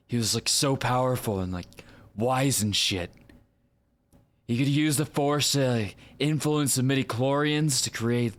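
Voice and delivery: animated, slangy dude storyteller; hyped-up retelling, pitch lifting on the wild parts; Aaron Paul. Acted. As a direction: Jesse Pinkman-style delivery. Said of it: "actually do sound like Aaron Paul".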